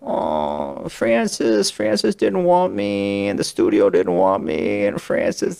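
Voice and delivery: soft hammy impression with big pitch swings; Al Pacino. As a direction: play a star retelling his grievance, drawn out. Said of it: "every story was like".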